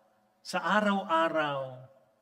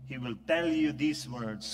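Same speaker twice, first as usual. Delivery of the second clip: ordinary delivery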